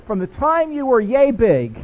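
ordinary delivery

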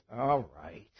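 low, soft, easy "all right"; calm Jesus voice. Retold or imitated. Preacher voicing Jesus answering his prayer, low and soft. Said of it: "And Jesus goes".

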